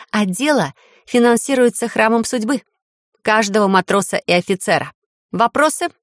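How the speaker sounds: measured official verdict; formal speech. A narrator's reading. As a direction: speak like a judge. Heroine announces regime.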